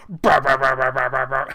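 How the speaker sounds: loud blaring bah bah bah; harsh buzzer noise. Retold or imitated. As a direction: blare it like an alarm. Imitating the toy's harsh noise.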